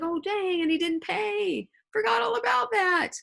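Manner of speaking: high-pitched comic retelling of an outburst; hammed-up "oh no" in a high voice; mock sudden realization. Acts out Silver suddenly remembering debt.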